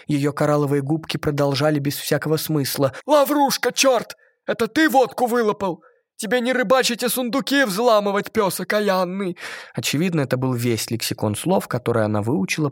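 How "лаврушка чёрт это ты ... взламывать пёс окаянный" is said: fisherman's shout. A narrator's reading. Mermaid shouting curses overheard from fishermen. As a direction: bark it like a rough fisherman. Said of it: "выкрикивала разные упрёки".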